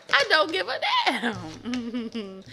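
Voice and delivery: slow defiant drawl. Retold or imitated. Acted thief defiance.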